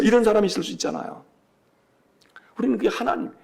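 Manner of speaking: usual speaking voice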